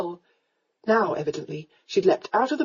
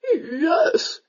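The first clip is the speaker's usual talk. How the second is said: drawn-out, high-pitched mimicking; mocking mimicry; 8 semitones higher than usual. A narrator's reading. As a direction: mimic her in a raised, teasing voice. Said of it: "he mimicked".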